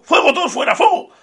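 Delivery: high, loud panicked yelling; mock the frantic security guard. Mocking the nervous guard shouting to evacuate, high panicked yells. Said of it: "Benito nervioso".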